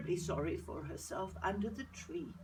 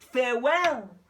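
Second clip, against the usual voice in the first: loud farewell; 6 semitones higher, 11 dB louder than usual. Acted. Lady bids farewell loudly.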